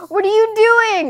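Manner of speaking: high, loud exasperated outburst. Real, not acted. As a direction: throw the question out in disbelief. Outraged at lying to partner.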